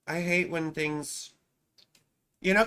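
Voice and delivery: normal talking voice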